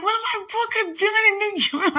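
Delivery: high, squealing disbelief; high-pitched delighted shriek; excited squeal. Retold or imitated. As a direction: replay the thrilled shriek. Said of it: "But I was like".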